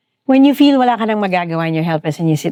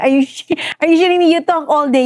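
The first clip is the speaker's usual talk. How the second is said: incredulous retort; 7 semitones higher, faster than usual. Retold or imitated. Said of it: "I'm like".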